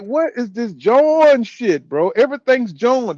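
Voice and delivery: drawn-out baffled complaint; incredulous. Retold or imitated. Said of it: "Bro, I'm just like".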